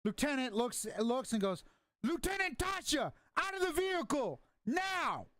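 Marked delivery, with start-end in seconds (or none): 2.0-5.3 high, forceful order; commanding shout. Acted. Lieutenant shouting an order at Tasha to leave the vehicle. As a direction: bark the command.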